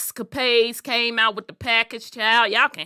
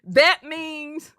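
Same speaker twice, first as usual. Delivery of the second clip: higher, louder, laughing lecture; loud explaining to auntie; 5 semitones higher, 5 dB louder than usual. Retold or imitated. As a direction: set auntie straight with a loud grin. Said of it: "I'm like".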